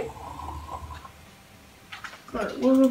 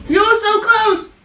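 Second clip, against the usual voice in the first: scared yell; 6 semitones higher, 5 dB louder, slower than usual. Real, not acted. Spider creeping up in game.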